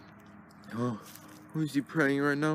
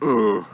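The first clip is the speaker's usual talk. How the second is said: loud groan of disgust; disgusted ugh; 5 semitones lower, 6 dB louder than usual. Pretend. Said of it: "I could be like".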